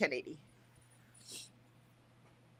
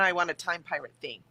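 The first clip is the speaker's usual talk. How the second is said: ordinary delivery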